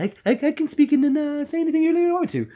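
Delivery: very high, quick cartoon baby voice; high-pitched, fast little character voice; Stewie Griffin. Shown as a demonstration. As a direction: climb way up and rattle it off. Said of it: "But, uh, Stewie Griffin".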